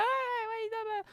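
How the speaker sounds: high, slow mock mom greeting; imitating mom. Mum surprising her son in town, high mock greeting.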